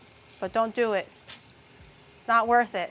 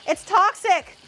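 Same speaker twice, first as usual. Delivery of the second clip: excited rant; 6 semitones higher, 6 dB louder than usual. Real, not acted. Ranting warning to chat.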